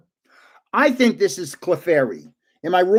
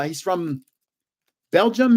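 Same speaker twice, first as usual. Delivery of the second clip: ordinary talk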